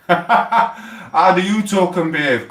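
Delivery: mocking mimic. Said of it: "the way he talk and behave".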